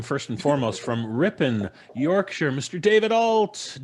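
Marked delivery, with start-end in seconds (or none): 2.5-3.8 high, loud showman welcome; announcer intro. Acted. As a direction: call the name like a ring announcer. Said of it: "host announcing guest".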